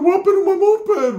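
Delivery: high, loud imitated wail; mock outraged primo. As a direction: cry out over wrecked property, hammed up. Primo upset over moped, retold.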